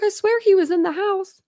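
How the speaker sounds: imitating a frantic swear; retold panic. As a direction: replay your frantic insistence, half-playful. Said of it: "and I was freaking out".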